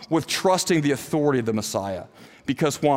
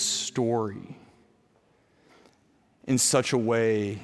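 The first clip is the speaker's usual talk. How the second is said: closing prayer; 6 semitones lower, 6 dB quieter than usual. Real, not acted. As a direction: pray quietly, pausing between phrases.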